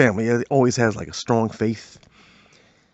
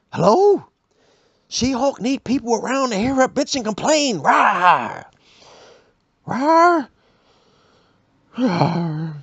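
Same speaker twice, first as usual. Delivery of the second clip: high, hammed-up roaring monster; She-Hulk roar; 9 semitones higher, slower than usual. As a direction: roar it out, comic brute. She-Hulk calling in ghost town.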